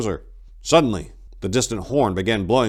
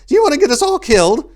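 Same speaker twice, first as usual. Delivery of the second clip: high, fast, loud frightened outburst; terrified monk snapping at his leader; panicked shout; 11 dB louder, faster than usual. A narrator's reading. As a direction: shriek it at him in fear. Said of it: "shifting his eyes about in fear".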